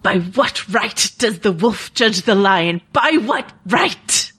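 a brooding knight's speech, dragged slowly, ending in a pointed question; Jaime Lannister impression. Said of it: "I'm gonna do my best Jaime Lannister here".

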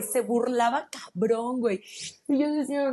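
ordinary talk

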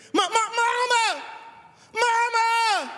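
put-on a child calling her mother, stammering with excitement; little girl excitedly calling mama to the window.

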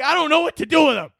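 high, frantic dad, fading at the end; out of ideas for the kid; helpless panic. Pretend. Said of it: "I'm gonna be like".

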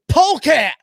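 high, loud goofy shout; an announcer yelling a silly nickname at a pole-sitter, high and loud; mock goofy yell. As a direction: blurt it out like a silly catchphrase. Imitating Clint Bowyer's outburst.

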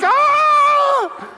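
very high, loud, sustained squeal; imitated elated scream. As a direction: shriek with mock overjoyed relief. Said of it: "She was like".

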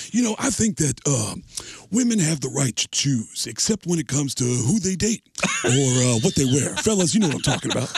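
sly, self-satisfied O J; O J Simpson. Retold or imitated. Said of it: "He'll go".

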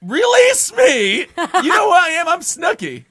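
high shrill shout, Snooki style; Snooki screaming. Retold or imitated. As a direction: scream it like she's being arrested. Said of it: "what did she scream when she got caught".

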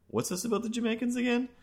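a high bewildered what's this; baffled question. Retold or imitated. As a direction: ask it like you can't believe it.

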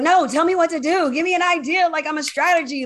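insistent protest. Retold or imitated. Acted protest to her mentor's advice.

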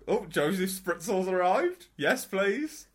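lightly voiced pompous host arriving; grand announcer. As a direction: announce yourself with a touch of pomp. Announcing his own stage name.

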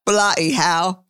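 lightly put-on British accent; amateur English accent for fun; mock British accent. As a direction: try out a playful British accent. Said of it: "I'll have an accent".

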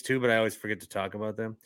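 ordinary delivery

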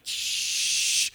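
long, loud hushing hiss; exaggerated funeral "shh"; loud shushing. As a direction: imitate a congregation shushing sharply. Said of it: "everybody's like".

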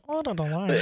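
a hint of a prim lady; prim fancy phrasing. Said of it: "you say it like you're fancy".

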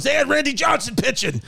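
high-pitched mock exclamation; dad's protest. Son imitating dad excusing his lost bet, high mock protest.

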